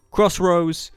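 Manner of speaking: ordinary delivery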